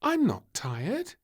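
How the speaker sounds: put-on high, slow, insistent little girl; stubborn. Little Princess insisting she's not tired, slow and stubborn. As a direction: drag it out, refusing to give in. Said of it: "said little Princess stubbornly".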